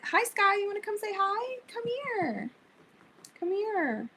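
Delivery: pet voice. Real, not acted. Calling her cat over.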